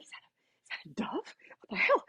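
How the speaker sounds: breathy unvoiced whisper, high and quick; hushed astonished whisper; startled whisper. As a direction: whisper it in disbelief. Stunned at dove, whispered.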